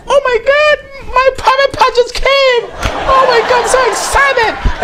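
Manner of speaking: very high, hammed-up squeal of delight; squealing excited bride. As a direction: shriek with joy like a giddy bride. Woman's joy at her wedding.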